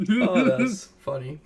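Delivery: high, loud amused laugh-talk. Real, not acted. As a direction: still giggling. DM still laughing.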